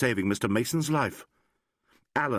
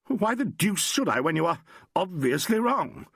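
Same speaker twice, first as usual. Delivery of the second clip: raised, irritated retort; exasperated Mason, pitched up; irritably; 9 semitones higher than usual. A narrator's reading. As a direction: snap back at the doctor, higher. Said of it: "said Mason irritably".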